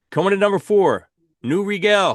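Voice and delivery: loud, high announcer call falling at the end; countdown announcer. Real, not acted. Host introducing number six in the album countdown, announcer style. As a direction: announce the chart entry with punch.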